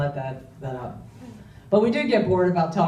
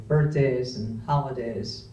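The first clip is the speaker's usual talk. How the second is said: usual speaking voice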